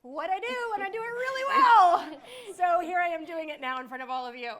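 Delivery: laughing through tears, voice breaking high and loud; choked up, pushing the words out through real tears; tearful, half-laughing confession of crying. Real, not acted. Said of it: "sorry, I'm a little emotional".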